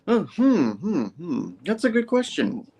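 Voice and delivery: high, swooping hums of exaggerated thought; playful put-on deliberation; mock pondering. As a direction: stall theatrically, as if truly stumped. Acts out Peter stalling on question.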